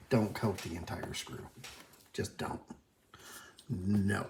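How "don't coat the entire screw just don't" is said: whisper. Real, not acted. Aside advice, unvoiced.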